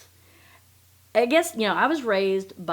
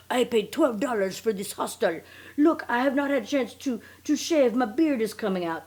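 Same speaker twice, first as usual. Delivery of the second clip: higher mock French accent; put-on French accent; 4 semitones higher than usual. Swindler showing off his hostel life, mock French accent. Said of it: "I make him have a French accent".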